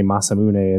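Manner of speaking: Masamune said as "mah-sah-moo-nay"; Japanese-style pronunciation. Shown as a demonstration. Quoting the game's voice acting, Japanese-style pronunciation. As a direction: finish Masamune on a sounded "nay". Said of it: "It makes more sense with Japanese pronunciation".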